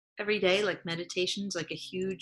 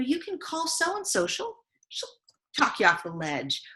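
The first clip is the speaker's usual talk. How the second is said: encouraging inner voice; 6 semitones higher than usual. Retold or imitated. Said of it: "that inner badass voice".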